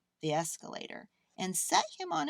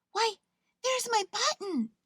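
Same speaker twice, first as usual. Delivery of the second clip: high excited cry of discovery; 14 semitones higher, 4 dB louder than usual. A narrator's reading. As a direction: cry out with joy at finding it. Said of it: "he cried".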